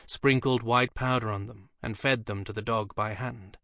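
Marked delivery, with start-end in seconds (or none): none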